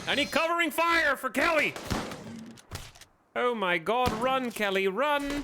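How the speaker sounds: high, loud battle cry; shouting orders over battle. Real, not acted. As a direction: yell the orders over the fight. Calling out during battle.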